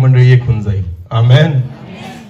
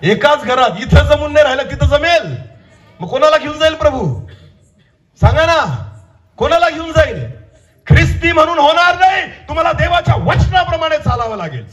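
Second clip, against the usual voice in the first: high-pitched, urgent preacher's cry; impassioned preaching; 12 semitones higher than usual. Real, not acted. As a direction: challenge the congregation in a high, insistent voice. Preaches at full force. (आवेशपूर्ण, चढ्या आवाजातलं प्रवचन)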